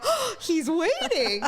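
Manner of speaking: high, slow, amused through laughter; delighted giggle at the sight; giggling that he's waiting; laughing. Real, not acted. Spotting the guy waiting, amused.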